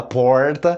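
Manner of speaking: "porta" with a retroflex R; São Paulo countryside R. Shown as a demonstration. Teacher shows a regional variant, countryside-R demonstration. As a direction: curl the tongue back in "porta". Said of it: "como em São Paulo, interior ali".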